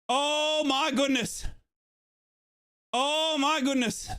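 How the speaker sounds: normal talking voice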